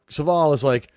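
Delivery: ordinary talk, nothing marked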